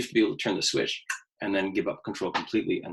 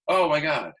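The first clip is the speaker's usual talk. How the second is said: mock alarm; 6 semitones higher, 7 dB louder than usual. Retold moment of panic.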